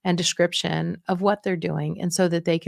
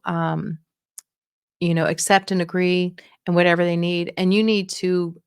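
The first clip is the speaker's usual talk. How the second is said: ordinary delivery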